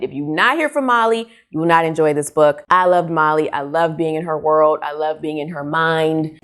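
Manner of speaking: ordinary talk, nothing marked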